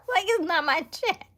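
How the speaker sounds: loud, high, laughing excitement; real fangirling. Real, not acted. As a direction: gush like a fan, breaking into laughter. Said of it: "like, fangirling".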